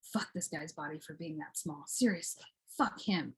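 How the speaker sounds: low, very soft cursing; muttered grumble. Pretend. Griping to oneself about his smallness, muttered grumble. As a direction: grumble it under your breath.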